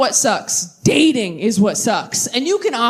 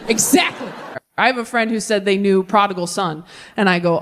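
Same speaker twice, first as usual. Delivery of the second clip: ordinary delivery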